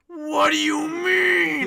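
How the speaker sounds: drawn-out monstrous shout; demonic girlfriend voice. Acted. Girlfriend answering a busy boyfriend, slow demonic roar. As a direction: roar it slowly in hammed-up outrage. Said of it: "maybe it gets really low".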